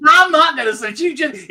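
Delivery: loud, high-pitched protest; shouted refusal. Real, not acted. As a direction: refuse at the top of your voice. Refusing the friend's loud goading.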